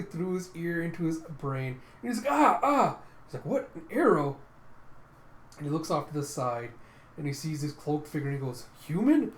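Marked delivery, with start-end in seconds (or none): 2.3-3.0 two high, loud cries of pain; pained yelps. Retold or imitated. Morse hit in the ear by an arrow, pained yelps. 3.5-4.4 higher, loud startled question; startled disbelief. Retold or imitated. Morse finding an arrow in his ear, startled outcry. 8.9-9.3 high, loud astonished question; startled exclamation. Retold or imitated. Morse spotting the cloaked figure, loud startled question.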